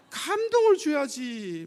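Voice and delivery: high, loud, drawn-out plea; emphatic exhortation. Real, not acted. Preacher urging believers to move their families, emphatic. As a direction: stretch it out and raise the voice.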